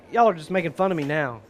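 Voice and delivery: ordinary delivery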